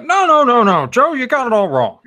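loud, high-pitched frantic objection; Tyler. Retold or imitated. Imagined friend barging in to correct Joe, loud protest. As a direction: burst in with a hammed-up rapid protest. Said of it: "Tyler just pops in. It's like".

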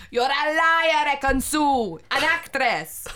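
Italian accent imitation, playful; mock Italian accent. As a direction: put on a bad Italian accent, outraged. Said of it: "He's, like, Italian or something".

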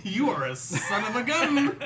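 high, slow, laughing jab; laughing mock scold. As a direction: tease him through laughter.

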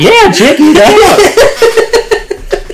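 very high, stretched-out loud cry; drawn-out squealing whoop; mock exhilarated yell. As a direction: show off with hammed-up glee, laughing. Mocking Peter showing off.

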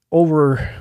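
normal talking voice

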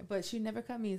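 ordinary talk

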